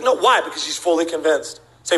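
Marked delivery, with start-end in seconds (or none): 0.0-0.4 higher-pitched mock protest; frustrated little girl. Girl told the class is moving on, acted frustrated protest. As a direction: plead like a thwarted child. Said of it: "the little girl's frustrated".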